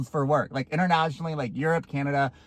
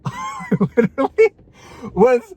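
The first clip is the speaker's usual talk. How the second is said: laughing; 4 dB louder than usual. Real, not acted. Laughing in disbelief.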